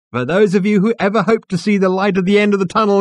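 high, loud compère projecting to the back of the room; big ringing stage voice; showman's announcement. Acted. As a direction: belt it out like a cabaret host.

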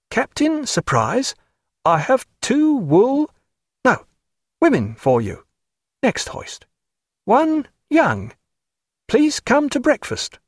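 halting high-pitched midshipman; slow read-off. A narrator's reading. Inexpert midshipman reading signal flags, slow and halting. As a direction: puzzle out the signal piece by piece. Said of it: "he slowly read it off".

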